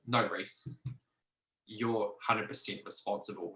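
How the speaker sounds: soft, understated doctor's reply; quiet firm verdict. Retold or imitated. Doctor tells patient who is responsible, quietly firm. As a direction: deliver the verdict quietly, with a pause.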